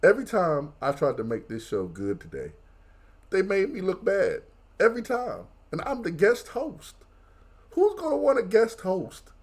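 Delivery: high, whiny promo rant; crying complaint. Acted. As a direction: plead like a wounded guest host, voice climbing. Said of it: "are you just crying".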